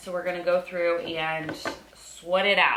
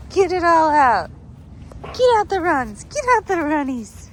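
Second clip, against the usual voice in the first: high, loud cheering; cheering the dog on; 9 semitones higher, 8 dB louder than usual. Real, not acted. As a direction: urge him to run it all out.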